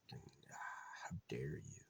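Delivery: whispered mock outrage as the bird. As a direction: hiss it like an offended little bird. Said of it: "he goes, just like".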